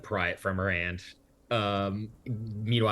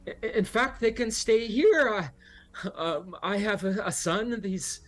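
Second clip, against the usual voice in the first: higher, fluttery and eager to help; eager hostess; 14 semitones higher, 4 dB louder than usual. Acted. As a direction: hurry to be helpful.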